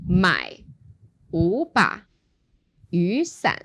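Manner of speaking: slow phrasing with pauses between units; grouped in units. Shown as a demonstration. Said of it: "So it goes".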